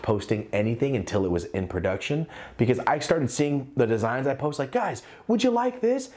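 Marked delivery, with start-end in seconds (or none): 4.7-6.1 much higher, casual and eager; high eager voice asking fans for feedback; friendly ask. Retold or imitated. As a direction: ask fans like you're texting a buddy.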